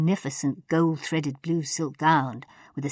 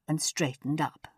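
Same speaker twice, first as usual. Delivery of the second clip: plain reading voice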